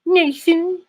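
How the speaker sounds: very high, hammed-up female English accent; squeaky My Fair Lady parody, English accent; mock English wife. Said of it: "then his wife's like".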